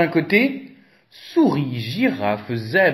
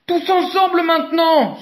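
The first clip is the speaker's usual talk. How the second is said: high, louder pleading call; 4 dB louder than usual. A narrator's reading. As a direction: beg everyone to push together. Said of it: "supplie Éléphant".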